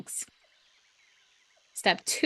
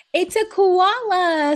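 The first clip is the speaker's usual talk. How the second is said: slow, higher, louder storybook wombat; wise old wombat; 10 semitones higher, 6 dB louder, much slower than usual. Acted. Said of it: "said a wise old wombat".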